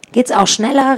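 high, urgent call. A narrator's reading. Narrator to fleeing friends to speed up, calling out. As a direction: call it out to hurry them along. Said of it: "rief ich".

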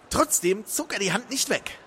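ordinary delivery, nothing marked

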